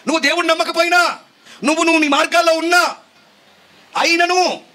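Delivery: loud proclamation. Acted. Preacher telling the unbeliever that God still provides, high loud proclamation. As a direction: proclaim it high and insistently, phrase by phrase. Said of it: "ఇప్పుడేం చెప్పాలి".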